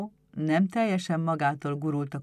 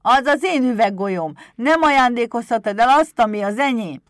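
high, shouted kobold tantrum; angry tantrum; 7 semitones higher, 12 dB louder than usual. A narrator's reading. Pumukli protests about his marble, high angry shouting. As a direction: rage like a stamping little imp. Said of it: "toporzékolt mérgesen".

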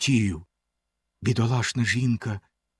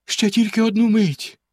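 higher begging plea; pleading; 8 semitones higher than usual. A narrator's reading. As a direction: beg for a moment more. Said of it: "благально мовила дружина".

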